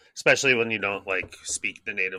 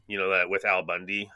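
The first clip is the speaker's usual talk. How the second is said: normal talking voice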